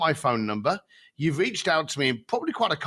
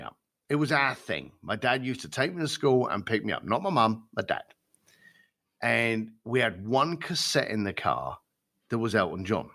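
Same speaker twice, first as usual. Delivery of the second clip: voice changed; 6 semitones lower than usual. Retold or imitated. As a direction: let the voice drop as the memory takes over. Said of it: "his voice changed, his tone changed".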